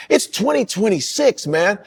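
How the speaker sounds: stretched, high-pitched mock protest; mock dismissive. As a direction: imitate someone impatiently telling you to get over it. Mimics people saying move on.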